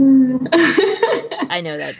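laughing through the words, slowed down; laughing while talking. Real, not acted. To cohost about absurd fics, laughing. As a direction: let the laugh spill into the words.

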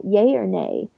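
ordinary talk, nothing marked

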